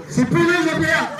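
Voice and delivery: very high laughing call; laughing call to Jean-Pierre. Real, not acted. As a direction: laugh it out to the booth.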